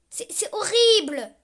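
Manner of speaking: high, loud, slow "horrible"; loud high drawn-out "horrible"; horrified cry. Real, not acted. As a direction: wail "C'est, c'est horrible!" at the ugly face. Repeated complaint, now shouted.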